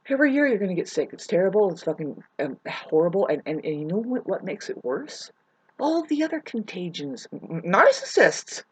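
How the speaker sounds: ordinary delivery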